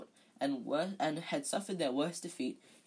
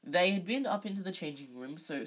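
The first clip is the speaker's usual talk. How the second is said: usual speaking voice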